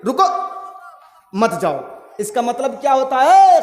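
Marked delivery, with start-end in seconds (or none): 0.0-1.8 रुको, a full breath, then a lower मत जाओ; pause after रुको. Shown as a demonstration. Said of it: "रुको के बाद आपको थोड़ा सा रुक जाना पड़ेगा, सांस लीजिए".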